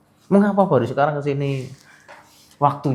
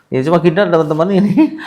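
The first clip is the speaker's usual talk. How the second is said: laughing; 6 semitones higher, 5 dB louder than usual. Real, not acted. Laughs at patient's reaction.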